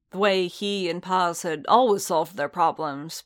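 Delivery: plain reading voice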